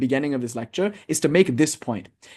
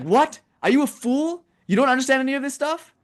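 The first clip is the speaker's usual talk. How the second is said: higher-pitched, incredulous scolding guru; high-pitched retold telling-off; mock scolding; 10 semitones higher than usual. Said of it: "then he gets scolded".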